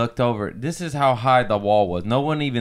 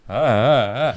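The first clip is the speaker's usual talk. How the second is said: falling cries; 4 dB louder, slower than usual. Retold or imitated. Reenacting the fall.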